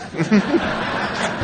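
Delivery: short breathy laugh up high; rueful high laugh. Real, not acted. Speaker to crowd about borrowing money, breathy laugh.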